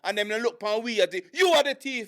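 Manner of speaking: high, agitated mock Jamaican scolding; hammed-up Jamaican cashier telling off a thief; Jamaican accent impression. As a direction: stammer out objections in a put-on Jamaican accent. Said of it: "it's like Italian versus Jamaican".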